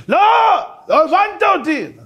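high, loud frustrated correction; exasperated shout. Retold or imitated. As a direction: shout that he was misunderstood. Philosopher insists.

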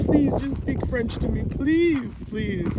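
high, drawn-out mock begging; pleading. Learner begging coworkers to speak French, pleading. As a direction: beg earnestly, stretching each please.

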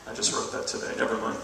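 usual speaking voice

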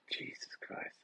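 a stunned whisper under the breath; awed whisper. Real, not acted. Streamer watching the lampshade move, whispered.

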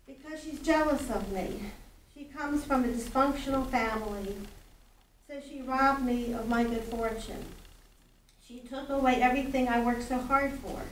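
plain reading voice

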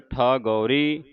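slow ਠਾਗੌਰੀ with long thaa; correct pronunciation. Shown as a demonstration. Teacher saying sound the long vowel, correct pronunciation. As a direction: slowly open the first vowel of ਠਾਗੌਰੀ wide. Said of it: "ਠੱਠੇ ਨੂੰ ਕੰਨਾ ਉਚਾਰਨ ਕਰਨਾ".

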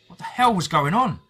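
loud, high, stunned outburst; exclaimed in disbelief. A narrator's reading. Narrator bursting out at the chaos, loud and high. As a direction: hit hell hard, voice jumping up in shock. Narrator repeats the question aloud.

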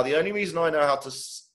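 plain reading voice

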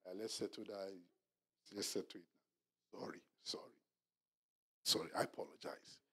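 hushed, soft, pleading whisper; whispered apology. Retold or imitated. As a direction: whisper the sorry to make peace. Pastor voicing apology to wife.

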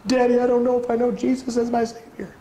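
put-on high little boy voice, worried; small child asking his dad; child's voice. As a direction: voice a small anxious son. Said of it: "he'd come to me".

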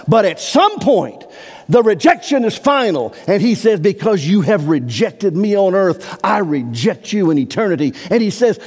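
high, loud pulpit preaching, pressing hard; fervent preacher at full cry; full-force preaching. Acted. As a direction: drive the scripture home from the pulpit.

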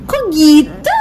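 put-on high coaxing baby voice. Coaxing a sulking pet bird.

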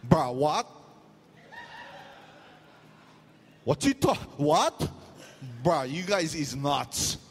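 shocked rookie refusing the paramedics, in Hawaiian Pidgin. Retold or imitated. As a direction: balk in Hawaiian Pidgin, then drag out "you guys is nuts" slowly. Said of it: "I wasn't speaking good English".

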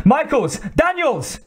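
very high-pitched scream of names; screamed warning. A narrator's reading. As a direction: scream out to warn them, pitch soaring. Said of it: "screamed Kozlowski".

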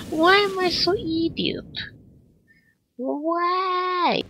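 very high squealed self-reproach; drawn-out squeal at your own blunder; mock despairing wail. Real, not acted. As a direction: wail it high, just after laughing.

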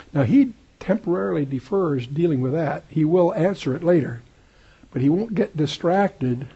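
ordinary delivery

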